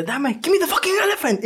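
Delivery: high-pitched imitation of a yelled demand; mock English yell. Retelling the carnival scene to a friend, mock yelling. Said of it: "en inglés gritando".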